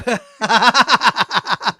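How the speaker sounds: loud, high laughing fit; real laughter. Real, not acted. Host laughing at his own crap joke, real loud laugh.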